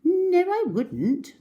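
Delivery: high, slow indignant no; small crocodile protest. Acted. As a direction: squeal it out. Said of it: "the not so big one said".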